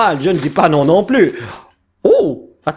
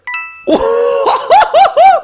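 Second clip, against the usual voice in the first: high burst of delighted laughter; excited laugh; 3 dB louder than usual. Real, not acted. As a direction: laugh out loud with joy. Double hit pull.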